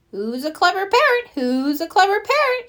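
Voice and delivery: high parrot mimicry. A narrator's reading. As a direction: repeat it like a pet parrot. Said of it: "she says".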